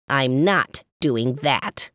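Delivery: slow, low crackling rasp; raspy fire voice. Acted. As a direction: growl it like crackling flames. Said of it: "his raspy and crackling voice".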